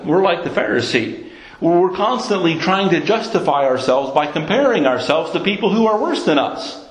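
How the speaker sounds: ordinary talk, nothing marked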